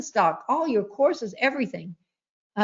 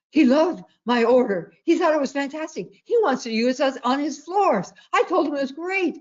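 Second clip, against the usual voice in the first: higher, eager retelling of the husband's news; retold excitement; 5 semitones higher than usual. Husband tells wife the market maker loved his order, excited and higher. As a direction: bubble over with his good news, pitched higher. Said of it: "He said".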